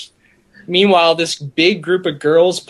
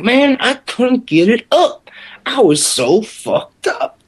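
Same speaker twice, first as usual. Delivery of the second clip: put-on drunk confession; 7 semitones higher than usual. Imitating drunk guy confessing failure.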